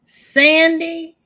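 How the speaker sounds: loud, high joyful call of a name; excited call. Real, not acted. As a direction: sing her name out in delight. Greeting a friend.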